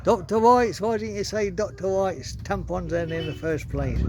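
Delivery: broadcast it to the whole shop. Retold or imitated. Deaf postmaster loudly repeats.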